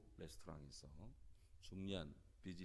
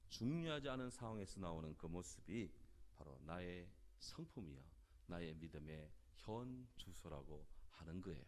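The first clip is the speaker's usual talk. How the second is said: ordinary talk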